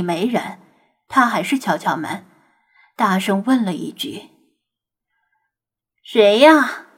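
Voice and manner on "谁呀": eerie, thin voice, a little louder; spooky ghostly call from inside; creepy thin voice. Acted. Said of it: "一道尖细且阴气十足的声音回应道".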